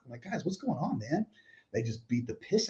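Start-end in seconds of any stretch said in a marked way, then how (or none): 0.2-1.3 confused pleading. Retold or imitated. Pleading with friends mid attack.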